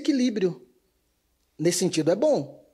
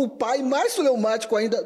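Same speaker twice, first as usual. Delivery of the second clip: normal talking voice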